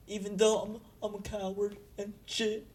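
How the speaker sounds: high, soft, halting timid voice; timid trembling. Acted. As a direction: ask it haltingly, small and nervous. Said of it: "kinda trembling".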